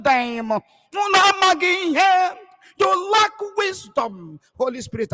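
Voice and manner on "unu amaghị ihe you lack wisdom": Kanu's rebuke shouted. Retold or imitated. Preacher quoting Kanu scolding the people, high shout. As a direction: hurl it out like the leader scolding. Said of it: "Nnamdi Kanu was broadcasting".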